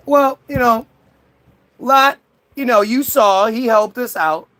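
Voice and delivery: high, ingratiating sales voice; butter up the boss; eager salesman. Retold or imitated. Salesman Paul sucking up to God, high and eager. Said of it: "Paul's like".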